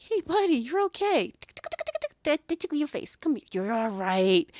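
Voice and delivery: high, bright cheerful coaxing to a nervous puppy; jolly him up. Retold or imitated. Groomer cheering up a nervous dog in the tub, high and jolly. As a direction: coax the dog up with a lifted happy voice. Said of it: "jolly him up".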